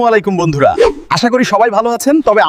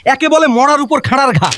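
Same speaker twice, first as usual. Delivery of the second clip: high-pitched exasperated lament; mild theatrical despair; 7 semitones higher than usual. Acted. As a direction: bemoan it with raised pitch. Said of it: "কপালে হাত দিয়ে হতাশ হয়ে".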